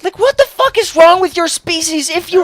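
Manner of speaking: high-pitched exasperation; real incredulous outrage; angry rant. Real, not acted. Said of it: "I'm sorry for that rant".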